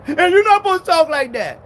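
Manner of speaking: loud mock correction; mock scold. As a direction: scold like an offended preacher. Mocking scolding preacher.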